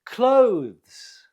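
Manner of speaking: "Clothes" as one high, loud, falling syllable. Shown as a demonstration. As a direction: say "Clothes" as a single beat. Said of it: "It's one syllable".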